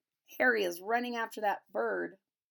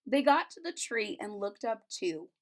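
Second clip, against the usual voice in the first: usual speaking voice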